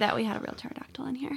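fast scared whisper. Acted. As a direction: hush it like it's still here. Mock fear after pterodactyl bit.

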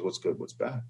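put-on the old farmer, quiet and unbothered; calm old farmer. Old farmer answering his neighbor, soft and calm. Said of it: "And the old farmer goes".

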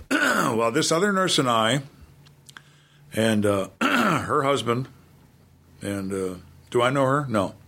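ordinary talk

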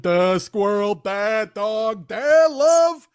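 slow, dopey awe, rising at the end; lightly voiced simpleton marvelling; a hint of a dumb, gushing viewer; mock dim-witted viewer. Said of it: "like a fucking mentally challenged person would".

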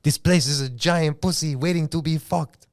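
Scarface impression. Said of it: "But he goes like".

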